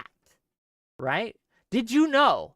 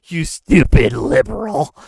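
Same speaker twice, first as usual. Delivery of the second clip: dismissive troll; 8 dB louder than usual. Retold or imitated. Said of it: "they're like".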